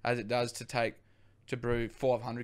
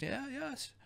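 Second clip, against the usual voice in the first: put-on Bert's German accent; 13 semitones higher, 11 dB quieter than usual. Bert agrees to brew beer.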